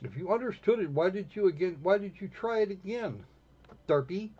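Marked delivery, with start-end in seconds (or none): none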